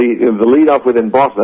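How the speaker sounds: ordinary talk, nothing marked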